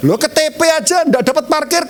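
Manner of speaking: ordinary delivery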